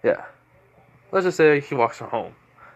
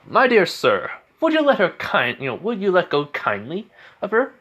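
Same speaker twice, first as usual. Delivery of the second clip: polite gentleman; 7 semitones higher than usual. Acted. Izuku politely threatens sludge villain.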